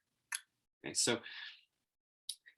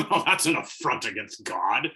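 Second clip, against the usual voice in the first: loud, hammed-up indignation; mock outrage; 9 dB louder than usual. Lecturer mocking adults upset by nonsense words, mock outrage. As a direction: declare it like a scandalized moralist.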